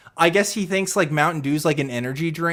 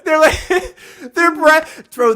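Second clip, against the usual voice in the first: laughing; 12 semitones higher, 8 dB louder than usual. Real, not acted. Cracking up at the roast.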